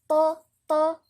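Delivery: dark, backed vowel on each طا; heavy Taa. Shown as a demonstration. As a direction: hit طا with a full emphatic T. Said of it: "نيجي عند الطاء نفخم".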